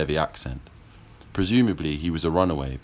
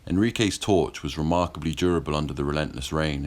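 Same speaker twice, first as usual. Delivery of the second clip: usual speaking voice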